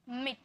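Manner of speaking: quick unstretched i in मिट; short i. Shown as a demonstration. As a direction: keep the vowel of मिट brief, "mit". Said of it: "तुरंत में sound आता है".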